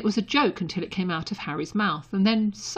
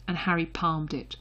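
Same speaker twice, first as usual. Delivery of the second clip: ordinary delivery, nothing marked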